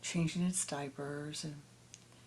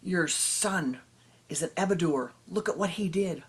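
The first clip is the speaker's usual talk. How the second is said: louder, indignant villager tirade; angry accusation; 7 dB louder than usual. Acted. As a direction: hurl the blame at the parents. Villagers berate the parents.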